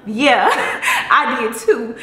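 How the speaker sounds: loud, laughing admission. Real, not acted. Vlogger jokes with viewers after sign-off, laughing. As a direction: laugh out loud through the words.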